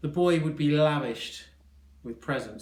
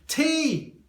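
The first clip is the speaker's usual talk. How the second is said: high, loud sudden cry; eager exclamation; 7 dB louder than usual. A narrator's reading. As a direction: blurt the letter high and loud. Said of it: "exclaimed the boy".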